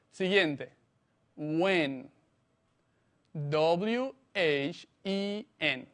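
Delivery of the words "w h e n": slow, separated letters; letter-by-letter dictation for learners; spelling out. Shown as a demonstration. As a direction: spell slowly, one letter at a time. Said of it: "se escribe".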